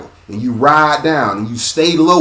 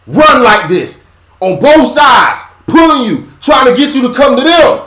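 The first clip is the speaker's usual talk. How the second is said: rising higher and louder to a cry; building preaching shout; 11 semitones higher, 6 dB louder than usual. Acted.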